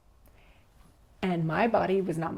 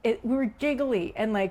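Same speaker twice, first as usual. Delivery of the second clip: usual speaking voice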